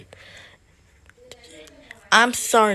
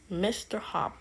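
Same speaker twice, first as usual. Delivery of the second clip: usual speaking voice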